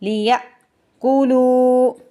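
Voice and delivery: लियअकुलू with the lam vowel drawn long; lengthened lam. Shown as a demonstration. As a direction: stretch the लू in लियअकुलू. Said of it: "लाम को खींच के अदा करेंगे". (लियअकुलू में लाम को खींच कर)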